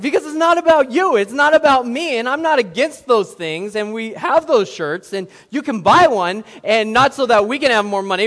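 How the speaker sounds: loud, high, fervent preaching; high, loud preacher's climax; preaching at full force. Acted. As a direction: build the fire and drive it home.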